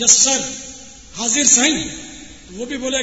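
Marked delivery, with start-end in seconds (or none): 0.0-1.9 loud, eager servile reply to a rich man; bow and scrape loudly; fawning yes-sir. Retold or imitated. Naat reciter fawning over a rich host, loud and servile.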